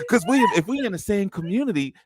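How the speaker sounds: high, teasing mimicry of the co-hosts; mock them in a silly high voice; mocking imitation. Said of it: "act like y'all was saying the same thing".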